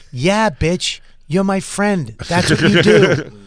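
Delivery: mock the friend bossing you into it; playful impression of a buddy calling dibs on a ride; cocky demand.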